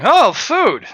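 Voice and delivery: the greedy monk spots a meal; excited about food. Acted. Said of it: "bronze tier adventure monk going".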